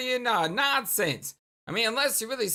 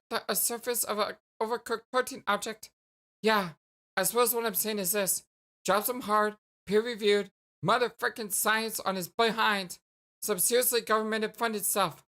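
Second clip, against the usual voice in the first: ordinary talk, nothing marked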